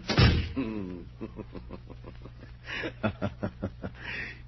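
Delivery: drawn-out breathy madman's laugh; a long gloating villain's cackle; long sinister laugh. Acted. Alone with the hands, a long sinister laugh. Alone after they leave.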